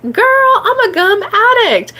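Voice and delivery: put-on high, loud girlish gush; excited. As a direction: squeal it to your friend. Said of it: "I was like".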